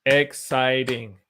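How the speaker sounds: Exciting slowly, ex-ci-ting, each syllable drawn out; slow syllable demo. Shown as a demonstration. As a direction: spell Exciting out slowly by sound. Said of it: "Three syllables".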